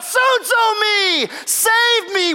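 very high, drawn-out shouted plea; crying out. Retold or imitated. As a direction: cry out for rescue from the waves. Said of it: "He cried out".

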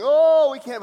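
loud, high complaining moan; hammed-up helpless whine; mock moaning. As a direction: moan it out, stretching the "oh". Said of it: "Moaning and complaining".

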